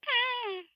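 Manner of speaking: high-pitched drawn-out squeal; mock scream. Creator acts out Susie, high mock scream. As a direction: imitate a character's little shriek.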